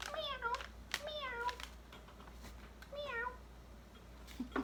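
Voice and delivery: little high meows fading away; soft puppet meows. Pretend. Cat puppet meowing at the real cat Malcolm, very high and soft.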